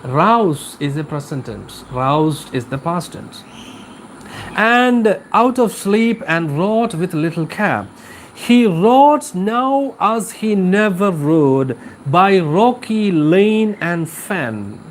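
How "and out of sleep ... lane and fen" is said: slow, measured reading of a ballad stanza, slowing into the last line; poem recitation. Acted. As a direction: read the verse aloud for the class, unhurried.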